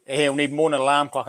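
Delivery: usual speaking voice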